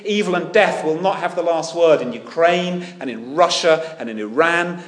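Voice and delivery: loud, high, building proclamation; preaching at full force. Acted. Preacher rallies church over war, full-force preaching. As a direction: proclaim it, lifting the room.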